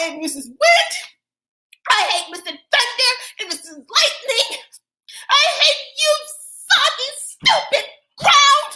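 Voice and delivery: put-on high, loud child's tantrum, shrieking at the sky; furious little girl yelling at the weather; temper tantrum yell. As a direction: scream it like a kid stamping her feet. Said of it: "She yells towards the sky".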